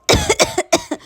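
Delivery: high, strained cough from smoke; harsh choking cough. Acted. Mona choking on chilli smoke, high harsh coughing. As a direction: cough hard, lungs full of smoke. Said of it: "Mona जोर जोर से खांसने लगी".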